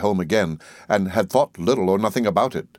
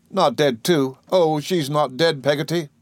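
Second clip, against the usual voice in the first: high, pleading questions; fearful child; 7 semitones higher than usual. A narrator's reading. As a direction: dread in a boy's high voice. Boy fears his mother dead.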